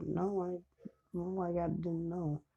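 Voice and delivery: soft, high backpedalling mom; lightly acted flustered apology; sheepish mother. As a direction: stammer it small and high. Mom backtracks after doubting son.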